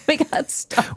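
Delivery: breathless laughing giggle. Real, not acted. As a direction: giggle it out, high and airy. Laughing at getting stuck.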